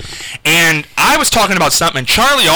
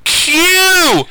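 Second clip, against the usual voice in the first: imitating Charlie; 8 semitones higher, slower than usual. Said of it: "He said".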